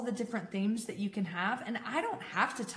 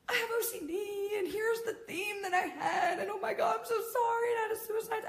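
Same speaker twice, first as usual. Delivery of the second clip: very high, wailing retold confession; mock bawling; 12 semitones higher than usual. Confessing to best friend, mock bawling. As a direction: blubber it out in an exaggerated crying voice. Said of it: "bawling, crying".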